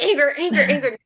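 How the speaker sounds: anger labeled high and loud; angry labeling. Retold or imitated. After a spiteful thought about the teacher, loud and angry. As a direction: let the flare of rage drive the words.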